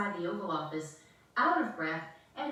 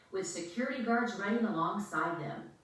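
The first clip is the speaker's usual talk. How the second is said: plain reading voice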